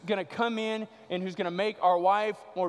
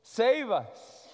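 mock cry, raised in pitch; 5 semitones higher, slower than usual. Jesus quoting the children's praise, raised crying out. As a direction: call it out as a quoted plea, higher. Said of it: "they cry out and say".

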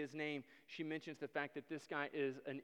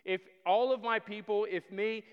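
loud, ringing plea to the king; preaching at full force; 5 semitones higher, 11 dB louder than usual. Acted. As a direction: cry it out like a desperate queen.

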